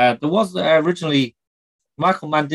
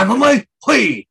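loud reply; 10 semitones higher, 7 dB louder than usual. Pretend. Replies as Pacino.

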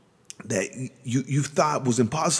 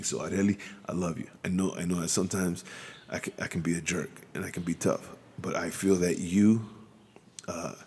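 quiet, low, choked-up voice; getting emotional; 6 semitones lower, 5 dB quieter than usual. Real, not acted. Husband telling his wife Arely he loves her, choked up and quiet. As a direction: speak softly, holding back tears. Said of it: "I'm getting emotional".

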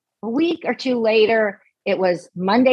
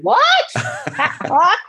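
very high shocked squeak; stunned high what; 14 semitones higher than usual. Retold or imitated. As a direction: squeak it out in disbelief. Shocked at hearing truth.

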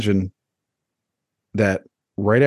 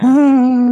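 mock crowd cheer; 7 dB louder than usual.

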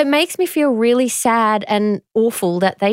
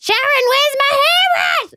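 much higher mock yell of a sister. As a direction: call out like an annoyed sister. Sisters yelling over a hairbrush.

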